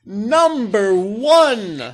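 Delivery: very slow, loud mock announcer; booming, stretched-out countdown call. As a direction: draw out each word like a big reveal.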